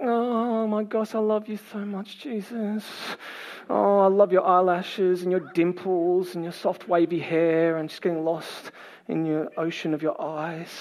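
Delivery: soft, slow, dreamy gushing; lovestruck Mary. Acted. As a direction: swoon adoringly, hushed and drawn out. Plays Mary swooning over Jesus.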